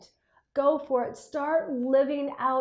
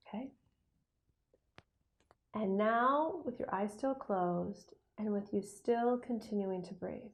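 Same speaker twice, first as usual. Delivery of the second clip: guided meditation. Acted. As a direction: guide them calmly with pauses. Leads a visualization.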